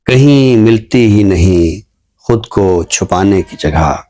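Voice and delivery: slow, measured ghazal recital, lingering on lines; poem recitation. Acted. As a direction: recite each couplet slowly, letting it land. Poet recites his ghazal.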